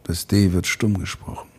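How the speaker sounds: film gunslinger line. Acted. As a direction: introduce yourself deep and measured. Voice actor quotes Django line.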